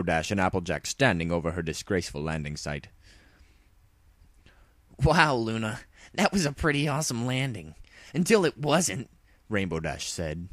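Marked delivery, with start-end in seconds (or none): 5.0-9.1 high, brash teasing voice; cocky tomboy pony. Acted. Rainbow Dash teasing Luna about her landing, high brash voice. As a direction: rib the princess about her crash.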